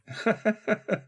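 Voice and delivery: high, amused laugh; hearty laugh. Real, not acted. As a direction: master laughing warmly at his butler. Master laughs at butler's joke.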